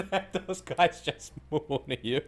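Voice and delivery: high, loud, drawn-out laughing talk; slow words squeezed through laughter. Real, not acted. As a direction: crack up while talking. Laughing at mooning game enemies.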